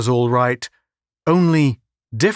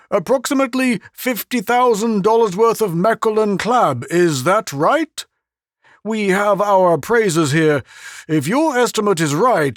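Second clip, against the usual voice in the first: higher, hearty voice of a pompous official; pompous boss voice, higher; breezy official; 7 semitones higher than usual. A narrator's reading. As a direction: wave the captain off cheerfully. Jovial official brushes off captain.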